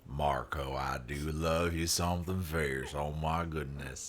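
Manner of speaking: low, soft Southern drawl; hushed, low Southern sweet-talk; generic Deep South accent. Acted. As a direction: lay on a Deep South cowboy voice. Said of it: "generic Deep South".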